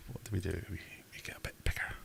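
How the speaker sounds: whispered aside to self; whispering. Real, not acted. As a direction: breathe it out, barely voiced. Whispered aside while resizing.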